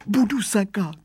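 put-on high whining boy. A narrator's reading. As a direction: whimper a promise like a scared rascal. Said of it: "kňučivým hlasem".

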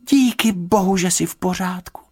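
high-pitched relief; high, heartfelt thanks; relieved exclamation. A narrator's reading. Girl relieved bear is safe.

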